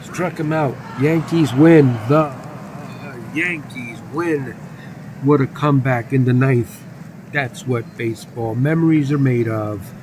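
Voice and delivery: loud game-winning call; triumphant play-by-play. Pretend. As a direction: proclaim the comeback win like a sportscaster. Calls the winning final out.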